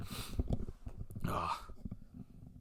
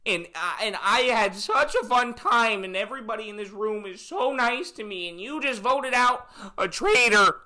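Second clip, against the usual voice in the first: hammed-up weepy goodbye; mock tearful farewell; 10 semitones higher, 4 dB louder than usual. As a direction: blubber theatrically, rising to a loud reveal. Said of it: "getting voted out like this".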